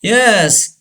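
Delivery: usual speaking voice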